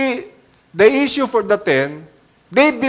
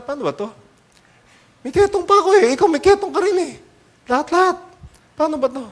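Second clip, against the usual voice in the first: much higher, fretful voice; worried lepers; 9 semitones higher, much faster than usual. Pretend. Lepers walking and worrying about their leprosy, in a high fretful voice. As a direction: fret in a high anxious voice.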